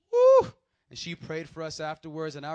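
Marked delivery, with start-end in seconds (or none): 0.1-0.5 sudden high shouted woo; preacher's whoop of excitement; loud whoop. Real, not acted. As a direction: let out a big whoop of release. Exclamation after story climax.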